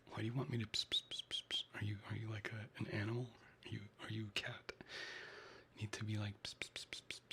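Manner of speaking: soft unvoiced whisper to chat; breathy close whisper greeting viewers; ASMR whisper. Real, not acted.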